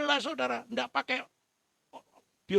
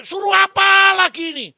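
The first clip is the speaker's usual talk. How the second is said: high, loud baffled cry; puzzled loud thought; 12 semitones higher, 12 dB louder than usual. Acted. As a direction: shout the slave's confusion. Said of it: "Bejo mikir gini". (teriakan bingung si budak)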